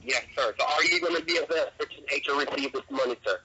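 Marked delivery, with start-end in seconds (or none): none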